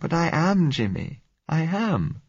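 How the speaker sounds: high-pitched, fearful protest; scared girl's plea, pitched right up; frightened young woman. Acted. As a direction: admit your fear to your sweetheart. Answers "don't be afraid.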